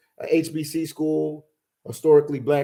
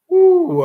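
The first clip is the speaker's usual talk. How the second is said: excited woo; 11 dB louder than usual. Real, not acted. Excited exclamation mid-story.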